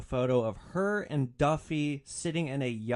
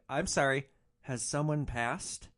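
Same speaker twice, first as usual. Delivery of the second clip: plain reading voice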